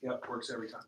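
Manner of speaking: low, very soft and bored; unenthusiastic salesman. Shown as a demonstration. Trainer mimicking a dull sales rep, unenthusiastic. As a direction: say it as pure routine. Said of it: "is that gonna get a customer excited".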